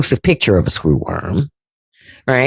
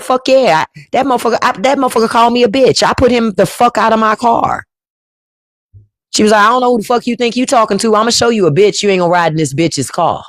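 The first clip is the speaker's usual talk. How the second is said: higher, fast, heated retelling of mom's rant; mock angry mother; 6 semitones higher, faster than usual. Mother telling her son about a rude date, mock furious. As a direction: spit it out like your outraged mother.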